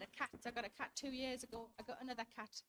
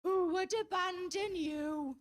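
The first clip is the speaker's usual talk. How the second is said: slow, louder, cooing over a pet; doting baby talk; 4 semitones higher, 7 dB louder, much slower than usual. Retold or imitated. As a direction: coo it like he's melting over the cat. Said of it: "he looks her right in the face and he goes".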